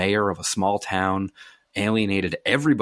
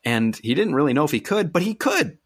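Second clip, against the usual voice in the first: building to a much higher, louder "could"; emphatic punchline; 7 semitones higher, 3 dB louder than usual. Real, not acted. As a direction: land the twist with a big upward leap. Punchline of the story.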